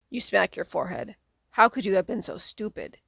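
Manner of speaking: usual speaking voice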